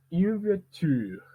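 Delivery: "une voiture" very slow, long falling "ture"; group-final stress. Shown as a demonstration. Teacher building a phrase, stress on the end of "voiture". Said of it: "l'accent tonique se va placer à la fin".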